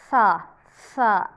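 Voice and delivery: सा with the tongue pulled back, a hissing s; wrong saa sound. Shown as a demonstration. Teacher showing students the mistaken hissing सा. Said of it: "जो कि wrong है".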